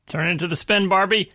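high, loud joking movie line; movie quote voice. Pretend. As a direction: deliver it like a film character. Quote in character voice.

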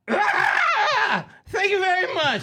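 very high strangled shriek into words; screech, then squeal the thanks in character; Bobcat Goldthwait. Shown as a demonstration. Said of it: "Let me see if I can do it".

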